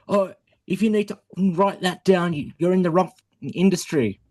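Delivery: retold scream. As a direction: imitate a boss's telling-off without shouting. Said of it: "he screamed at me going".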